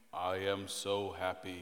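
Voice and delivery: low, soft, lifeless drone; joyless monotone. Acted. Dad imitating someone dreary to be around, low monotone. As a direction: say it like a gloomy schoolmate. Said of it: "Someone who's going".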